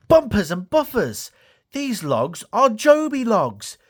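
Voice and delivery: high, slowing cry of discovery; amazed excitement. Acted. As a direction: realise it with delight. Thomas realises something amazing.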